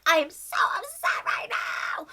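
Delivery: a mock tearful whisper, pitched high; imitating crying. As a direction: fake a teary, breathy complaint. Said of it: "Min Lee starts crying".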